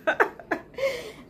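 a burst of high-pitched laughter. Real, not acted. Vlogger laughing at her own stubborn reply, real laugh.

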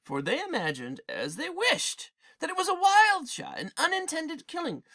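storyteller building tension; dramatic story reading. A narrator's reading. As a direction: read it like a rising epic tale.

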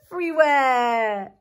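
ordinary delivery

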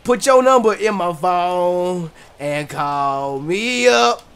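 ordinary talk, nothing marked

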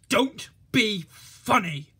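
loud, slow, high snap; snapping. A narrator's reading. Policeman snaps at Thomas, loud and sharp. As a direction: cut him off sharply. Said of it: "snapped the policeman".

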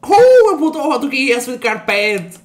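loud, high yell that slows down; loud hype shout, then drawn out; excited shout. Real, not acted. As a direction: burst out yelling at your buddy. Hyped outburst at friend.

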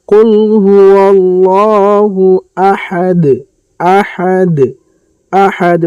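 slow melodic tajweed chant; measured Quranic recitation. Shown as a demonstration. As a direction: recite slowly, drawing out each vowel. Recites with qalqalah.

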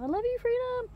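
high cooing pet voice. Real, not acted. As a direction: coo it sweetly to the bird. Cooing to her pet parrot.

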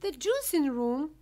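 high, loud shocked echo; cry out. A narrator's reading. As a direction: cry it out in horror. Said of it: "cried Missus Beauregard".

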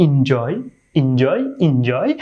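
এনজয় as "in-JOY", repeated slowly; short "i" at the start; corrected pronunciation drill. Shown as a demonstration. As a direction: lead learners in saying "in-joy". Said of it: "আমার সাথে সাথে চাইলে আপনারাও বলতে পারেন".

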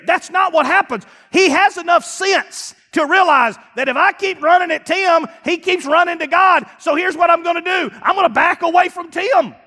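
loud, high preaching shout; fiery pulpit preaching; full-force preaching. Acted. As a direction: drive it home like a revival preacher.

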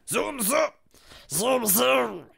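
high-pitched nonsense grumble; grumbling creature. Acted. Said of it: "he grumbled".

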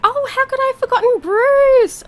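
loud high exclamation; exclaimed dismay. Real, not acted. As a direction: cry out at her own slip. Realises missing villager.

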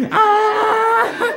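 high laughing fake cry; laughing mock yell. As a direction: yelp it out through laughter. Mock cry as Spider-Man.

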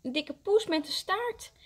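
put-on high, excited child's call. A narrator's reading. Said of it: "roept hij".

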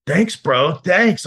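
high, loud, gushing imitation of the roommate; mock him thanking you too eagerly; hammed-up over-the-top gratitude; overeager thanks. Roommate voiced in retold scene.

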